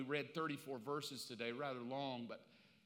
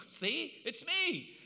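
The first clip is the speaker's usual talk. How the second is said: high, playful and a bit louder; much higher, singsong "see, it's me"; teasing reveal; 11 semitones higher, 3 dB louder, much slower than usual. Retold or imitated. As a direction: pop up and tease them. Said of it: "show back up and go".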